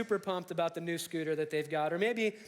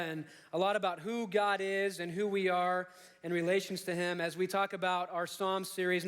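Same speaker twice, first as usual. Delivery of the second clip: ordinary talk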